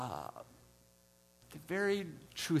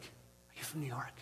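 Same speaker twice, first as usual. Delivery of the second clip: mock whisper, barely voiced; soft unvoiced ask; whispered question; 12 dB quieter, slower than usual. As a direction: hush it like a secret. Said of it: "But he's like".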